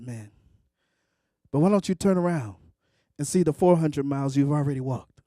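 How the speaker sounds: low, unhurried answering voice; calm inner voice. Retold or imitated. Voice on the mountain consoles angry hiker, calmly. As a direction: drop out of the rant into calm, low counsel. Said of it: "then I hear this voice".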